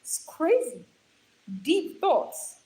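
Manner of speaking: ordinary delivery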